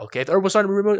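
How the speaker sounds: usual speaking voice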